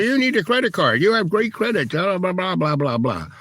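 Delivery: mock the bank's sales pitch; pushy bank voice. Bank keeps pushing a card.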